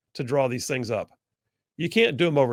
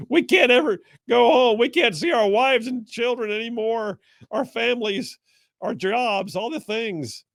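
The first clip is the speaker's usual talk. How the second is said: much higher mock lament; whiny lawmakers; 9 semitones higher than usual. Host mocking Democrats stuck away from home, high whine. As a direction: whimper like a self-pitying exile.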